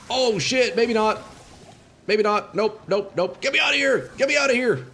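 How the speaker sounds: high, panicked yelps. Real, not acted. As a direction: panicky cries to get out. Swarmed by souls, panicking.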